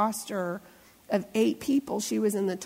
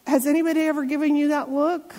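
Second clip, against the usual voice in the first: ordinary talk, nothing marked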